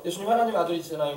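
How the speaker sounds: ordinary talk, nothing marked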